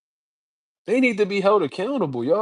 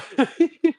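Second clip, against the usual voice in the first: high laugh; 13 semitones higher than usual. Real, not acted. Laughing at a rival's silence.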